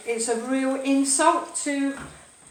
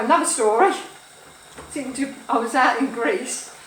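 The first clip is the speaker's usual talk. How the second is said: ordinary delivery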